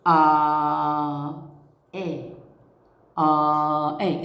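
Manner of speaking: a drawn آ then a light إي, slowly; sounding out the vowel. Shown as a demonstration. Teacher sounding out آ and إي for pupils, slow and clear. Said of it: "لفظها".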